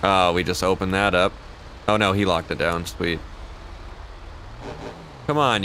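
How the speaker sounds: usual speaking voice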